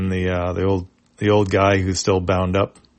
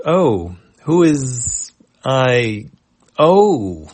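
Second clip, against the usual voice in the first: high, drawn-out dazed question; dopey confusion; 5 semitones higher, 5 dB louder, much slower than usual. Acted. Chipu is called fearless leader, slowly dopey and confused. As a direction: slowly realise you're the leader.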